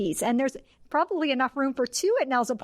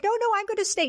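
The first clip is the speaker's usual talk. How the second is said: mock stubborn wife; 12 semitones higher, faster than usual. Imitating stubborn wife Grace.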